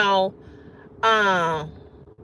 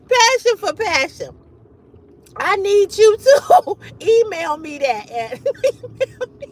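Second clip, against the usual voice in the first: higher and louder, breaking into laughs; laughing; 9 semitones higher, 4 dB louder than usual. Real, not acted. Asking a viewer to email the line, laughing. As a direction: talk through the giggles. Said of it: "I can't stop laughing".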